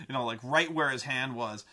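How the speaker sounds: normal talking voice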